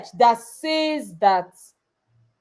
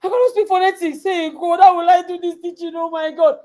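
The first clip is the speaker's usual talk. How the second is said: higher, fast anxious pleading; panicking; 6 semitones higher than usual. Retold or imitated. Speaker recalling fear of phonetics, mock panic. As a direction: panic about the teaching. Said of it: "I say".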